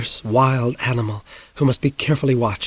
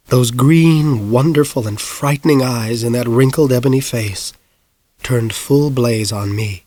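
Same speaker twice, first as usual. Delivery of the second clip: usual speaking voice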